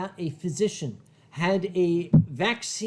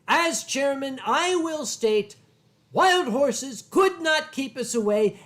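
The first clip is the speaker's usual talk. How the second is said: formal statement; 4 semitones higher than usual. Retold or imitated. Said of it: "My exact quote to them was".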